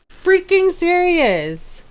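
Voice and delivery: high, drawn-out mock outrage; so mad. Streamer retelling discovery of reset settings, mock mad. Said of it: "I'm so mad".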